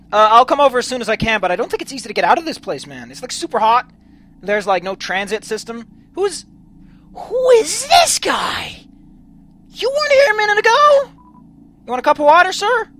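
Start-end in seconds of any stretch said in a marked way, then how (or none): 6.2-12.9 surprised. Acted. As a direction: gape at the sudden stranger. Startled by stranger, offers water.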